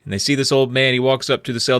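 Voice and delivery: normal talking voice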